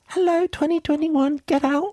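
new year on the doorstep voiced as a high cartoon character; talking new year. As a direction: shoo out the old year in a squeaky cartoon voice.

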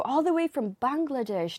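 normal talking voice

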